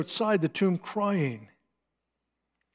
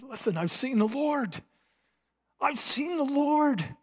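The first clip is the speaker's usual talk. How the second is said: much higher, slowing and stretching the second time; urgent excited telling; 10 semitones higher than usual. Acted. Mary grabbing the disciples' cloaks, high urgent excited plea. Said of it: "grabbing their cloaks and saying".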